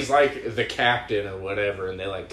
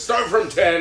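higher, louder correction; mock command; 10 semitones higher, 10 dB louder than usual. Retelling a comedy scene, higher, louder mock correction. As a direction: snap out the correction. Retelling a movie character's lines.

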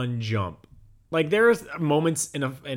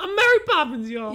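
high Mary Poppins voice; 13 semitones higher, 4 dB louder than usual. Pretend.

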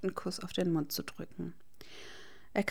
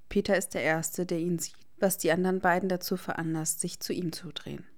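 plain reading voice

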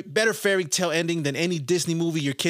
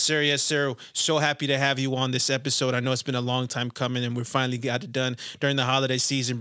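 ordinary delivery

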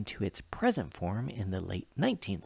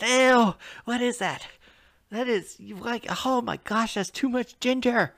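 much higher, mocking imitation of her disgust; disgusted friend; 13 semitones higher, 6 dB louder than usual. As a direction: squeal it like a grossed-out friend. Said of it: "She said".

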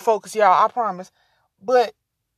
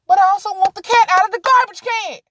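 exasperated; 9 semitones higher, 5 dB louder than usual. Real, not acted. Exasperated about cat.

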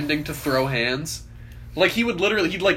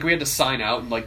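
normal talking voice

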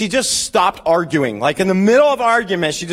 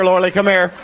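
ordinary talk

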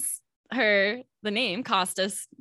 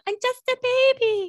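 put-on very high, helpless little-baby voice; hammed-up tiny, high-pitched voice; mock baby voice; 13 semitones higher than usual. Mocking a helpless little girl.

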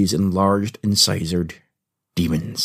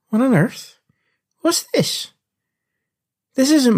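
rising much higher in playful surprise; mock surprise. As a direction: act baffled by the strange hat. Finds the wrong hat.

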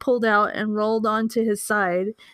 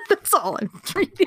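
laughing; 6 semitones higher, faster than usual. Real, not acted. Laughing, quitting the reading.

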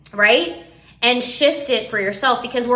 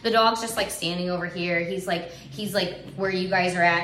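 ordinary talk

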